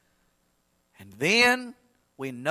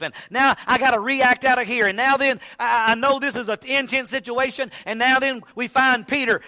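high, loud, driving sermon delivery; impassioned preaching; 9 semitones higher, 7 dB louder than usual. Acted. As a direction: press on urgently from the pulpit. Preaches with building force.

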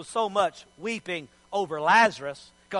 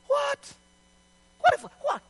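very high, sputtering disbelief; high-pitched mock shock; stunned disbelief. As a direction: squeak it out, scandalized and lost for words. Acting shock at the words.

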